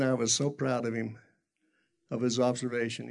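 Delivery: ordinary delivery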